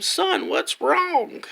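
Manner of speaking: put-on high, slow old lady; old woman asking gently, high and slow. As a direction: drag it out like a kindly granny. Old lady at the bus stop.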